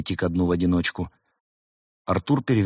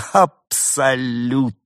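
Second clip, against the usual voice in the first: higher, drawn-out word shaken by laughter; slow, giggly confirmation; laughing; 5 semitones higher, 4 dB louder, much slower than usual. A narrator's reading. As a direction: stretch it out, barely holding the laughter in. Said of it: "продолжая трястись от смеха".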